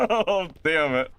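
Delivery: high laughing cry of dismay. Real, not acted. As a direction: laugh helplessly while cursing your blunder. Laughs over a failed elevator exit.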